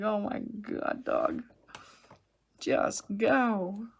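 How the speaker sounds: loud, high-pitched mock exasperation; exasperated. Owner to a dog that won't go poo, exasperated. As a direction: plead loudly with a stubborn dog.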